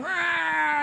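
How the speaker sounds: high revving car growl; imitated engine roar. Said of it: "my car is whining".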